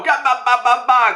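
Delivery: high, choppy "ga ba ba" laugh; high mock guffaw in syllables; imitated giant's laugh. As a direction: chant out a cartoon giant's belly laugh.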